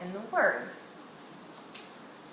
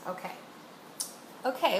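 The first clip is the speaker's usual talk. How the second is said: ordinary talk, nothing marked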